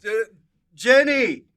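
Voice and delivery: very loud, high yell of a name; stammer, then holler the name; hammed-up shout across a room; comic calling out. Acted calling out in editing bit.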